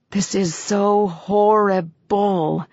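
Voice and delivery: "horrible" drawn out slowly in three syllables, first and last stressed; a slow, complaining "so horrible" with "horrible" stressed fore and aft; emphatic horrible. A narrator's reading. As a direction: lean hard on both ends of "horrible", very slow. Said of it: "making the word an emphatic three syllables with fore and aft stresses".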